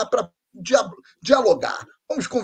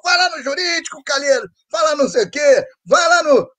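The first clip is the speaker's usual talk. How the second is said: higher, shouted mock order; imitating people bossing him around; bossy command; 6 semitones higher than usual.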